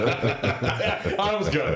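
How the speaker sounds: giggling, spread out slowly; laughing. Real, not acted. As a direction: laugh the words out. Laughing after botched twister.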